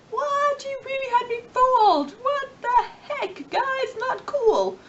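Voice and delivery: shrill mock outrage at being tricked; indignant shock. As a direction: play a fooled listener crying foul. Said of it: "everyone was like".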